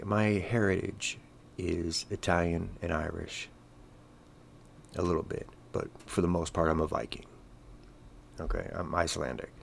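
ordinary talk, nothing marked

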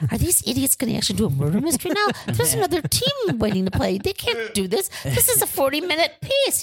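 high-pitched, incredulous mock outrage; retold fury. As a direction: act out indignant disbelief, pitched well up. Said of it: "Well, I was furious".

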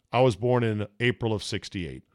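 ordinary talk

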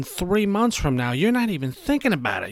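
normal talking voice

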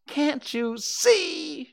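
very high, loud, drawn-out cry; exasperated shout in a small creature's voice. A narrator's reading. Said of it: "shouted Piglet".

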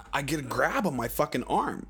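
ordinary talk

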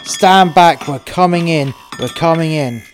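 loud, high order fading toward normal; officer warning he's breaking in; police shout. Retold or imitated. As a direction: bark it at the door, then ease down. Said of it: "he said".

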